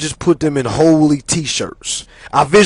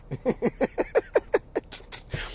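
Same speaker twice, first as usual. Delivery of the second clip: long wheezy laugh; helpless airy giggling at his own story; amused laugh; 6 semitones higher, 11 dB quieter than usual. Real, not acted. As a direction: crack up softly.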